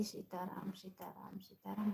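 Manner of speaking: hushed so people nearby won't hear; speaking softly. Real, not acted. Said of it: "बहुत slow slow बोल रही हूँ". (धीमी आवाज़ में)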